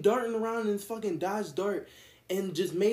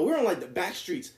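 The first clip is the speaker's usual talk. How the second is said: ordinary talk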